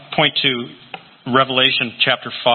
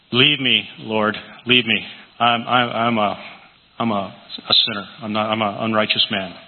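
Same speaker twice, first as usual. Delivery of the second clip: broken confession; 4 semitones lower than usual. Acted. Preacher voices Peter's confession.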